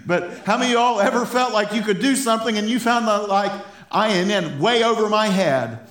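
fired-up preacher, high and loud. Real, not acted. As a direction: preach it with stumbling, heated energy. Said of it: "I'm fired up".

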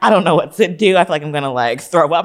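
loud, laughing mock freak-out; retold panic. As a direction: replay your panic at high volume, amused. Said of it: "I have a full-on anxiety attack".

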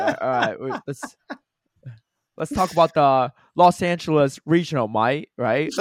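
hammed-up British "mate"; British accent. Shown as a demonstration. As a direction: put on a playful British accent. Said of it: "I speak in a British accent".

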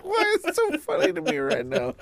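giggling helplessly through the words; can't stop laughing at the pun. Real, not acted. Laughing at his friend's pun. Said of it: "You, you okay over there, bud?".

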